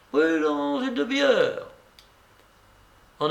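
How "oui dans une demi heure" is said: lightly voiced coquette, pitched higher; a hint of a teasing young woman; Blanche. Blanche bossing the narrator, lightly played higher.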